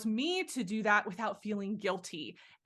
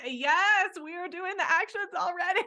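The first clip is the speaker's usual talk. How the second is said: excited yes; 9 semitones higher, 4 dB louder than usual. Retold or imitated. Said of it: "So I'm like".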